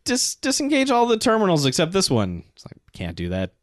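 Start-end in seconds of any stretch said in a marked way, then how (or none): 0.1-2.4 captain's order, pitched way up; high mock captain. 2.9-3.5 low, soft calm machine; deadpan HAL refusal, low and quiet; HAL computer voice. Retold or imitated. HAL-style computer reply.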